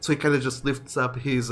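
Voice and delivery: ordinary talk, nothing marked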